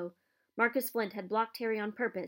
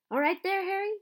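shouted much higher and louder; yell; 10 semitones higher, 6 dB louder than usual. Acted. Fred calling to Harry while chasing a bludger, quick yell. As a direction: call out across the pitch mid-flight. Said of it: "he had time to yell".

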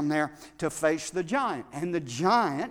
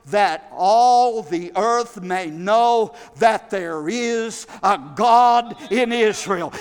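rising, louder sermon delivery; full-force preaching; 4 semitones higher, 7 dB louder than usual. Acted. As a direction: preach it, building with each line.

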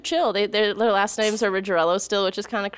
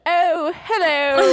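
high, slow, posh-put-on British hello; mock British accent; 9 semitones higher, 5 dB louder, much slower than usual. Friend wants to sound British in London, mock British accent. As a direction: stretch it out in a fake British lilt. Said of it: "I can't do a British accent".

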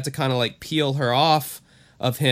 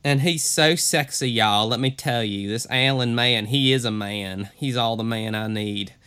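hammed-up Southern twang, dropping lower; 3 semitones lower than usual. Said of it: "a little on the hick side".

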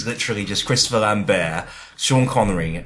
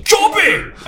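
very high, loud yelp; sudden high-pitched mock Scottish cry; Billy Connolly impression; 7 dB louder than usual. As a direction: pop in with a piercing comic shout. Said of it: "Billy Connolly just pops up and goes".

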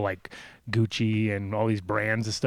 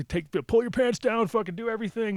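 searching officer; 10 semitones higher than usual. Retold or imitated. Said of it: "he totally searches me".

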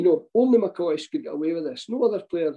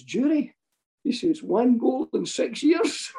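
higher, slow, teasing disbelief; mock disbelief; 8 semitones higher, slower than usual. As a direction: drag it out like a cheeky striker. McCoist mocking the goalscorer.

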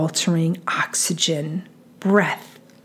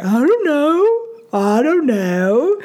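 slow, swooping "I don't know"; exaggerated, stretched-out kid's excuse; imitated whiny kid; 6 semitones higher, much slower than usual. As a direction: drag it out in a put-on kid voice. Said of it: "how many times do you hear".

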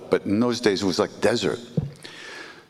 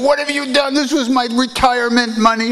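much higher and louder, panicked wail; distraught outburst; 7 dB louder than usual. Retold or imitated. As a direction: cry out over lost savings. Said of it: "and the guy flipped out".

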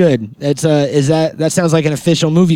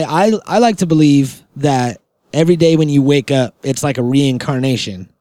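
ordinary delivery

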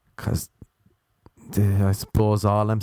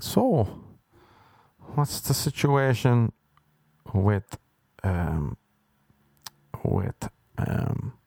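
imitating slow talker; much slower than usual. Visitor asking host about the room: slow drawl, trailing off. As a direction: drag every word out painfully. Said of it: "he kept talking so slow".

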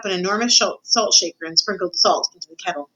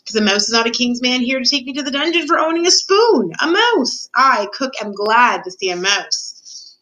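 high, emphatic voice for a cook; animated story Cook; 6 semitones higher than usual. Acted. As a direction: rise in indignation, then draw out the last words.

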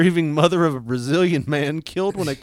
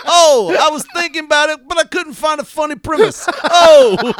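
loud, high whoops of shock; real loud laughing shock, much higher; shocked outburst; 11 semitones higher, 10 dB louder than usual. Real, not acted. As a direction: yelp at the dark joke.

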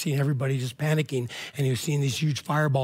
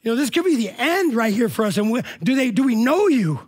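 raised, pleading cry to God, higher and louder; desperate prayer; 7 semitones higher, 5 dB louder than usual. Retold or imitated. Man in the burning tower pleading with God, raised desperate cry. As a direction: beg God as the world collapses around you. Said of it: "And he said".

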